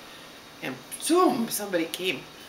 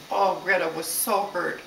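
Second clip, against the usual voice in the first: ordinary delivery, nothing marked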